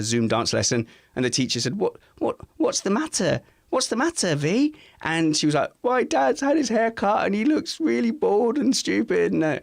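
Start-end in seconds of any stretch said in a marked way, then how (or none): 1.8-4.7 high, gentle "what's the matter"; a teacher soothing an upset pupil; concerned teacher. Retold or imitated. Dance teacher asks upset girl. 5.8-9.3 put-on high, aggrieved child's complaint; a little girl telling on dad; sulky daughter.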